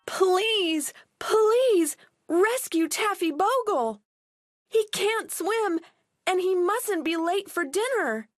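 high desperate plea; pleading cry. Acted. Girls beg the fisherman to save their dog, high plea. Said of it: "cried Millie and Molly".

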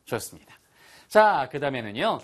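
usual speaking voice

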